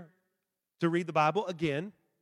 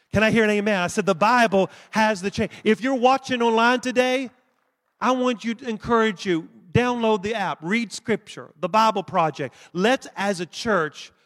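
loud fervent preaching; rally the room, full voice; 3 semitones higher, 5 dB louder than usual. Real, not acted. Pastor exhorting congregation.

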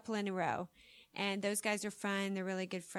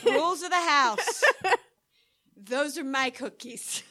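mock stern owner; 3 semitones higher, 6 dB louder than usual. Acting cookie owner.

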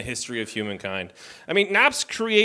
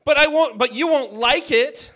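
mom voice; 7 semitones higher, slower than usual. Retold or imitated. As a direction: play the fed-up mom. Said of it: "she's like".

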